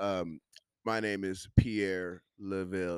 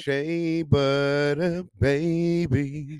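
slow, sassy and a bit louder; sassy drawn-out quip; 6 semitones higher, 5 dB louder, much slower than usual. Acted. Guest riffs on the host's hair story with a slow sassy quip. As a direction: drag it out with sass.

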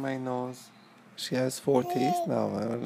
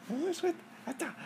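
put-on baby talk; 6 dB quieter, faster than usual. High fast baby talk.

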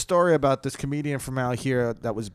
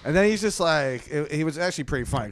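normal talking voice

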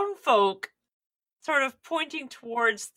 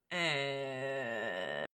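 bored grunt; 7 semitones lower, 13 dB quieter than usual. Acted. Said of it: "kinda go".

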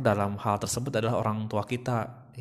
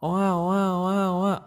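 high, louder mock infant wail; imitated baby cry; 8 semitones higher, 4 dB louder, much slower than usual. Shown as a demonstration. Baby signals mama it needs to pee, imitated high wailing. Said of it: "intonasi saya seperti ini".

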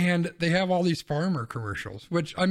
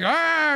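high, loud commercial yelp; a hammed-up scream from a TV ad; mock scream; 11 semitones higher, 6 dB louder than usual. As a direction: yelp like the panicked ad guy. Mocking a soda commercial.

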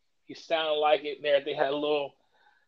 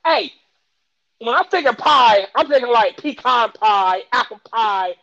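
loud, high, worked-up listing of pies; heated defense; 7 semitones higher, 8 dB louder than usual. Real, not acted. Defending Southern pie to his friend, loud and high. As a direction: argue your case, loud and high. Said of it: "defends his view of pie".

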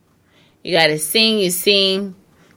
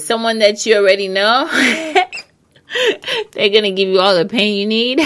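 laughing through the words; 4 semitones higher, faster than usual. Real, not acted. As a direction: giggle out the joke. Laughing joke.

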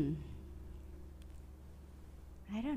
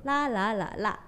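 La said in four tones: level, rising, dipping, falling; teacher demonstrating four different tunes on La; four Mandarin tones; 4 dB quieter than usual. Shown as a demonstration. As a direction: run La through each Mandarin tone in turn. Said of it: "you have, like, four intonations".

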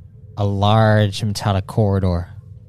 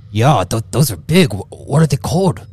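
higher, louder, excited brute; impressed Grunghill; 4 semitones higher, 5 dB louder than usual. Acted. Grunghill admiring the player's gauntlets, higher and excited. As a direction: gush over the big gauntlets.

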